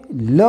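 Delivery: "ल" as luh, not el; phonic sound of L. Shown as a demonstration. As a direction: sound out "ल" low, with a neutral vowel. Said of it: "एलचा उच्चार आहे".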